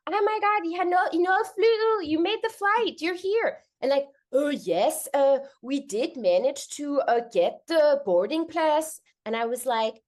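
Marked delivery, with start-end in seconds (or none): 0.1-3.5 high, gushing congratulations; re-enacted joy, pitched way up; excited greeting. Greets the couple, happy for them. 4.3-9.0 put-on stilted Danish accent, slowing down; halting put-on Danish accent; mock Danish-accented English. Mimics couple answering in English.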